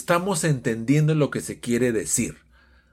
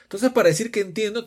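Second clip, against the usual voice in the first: normal talking voice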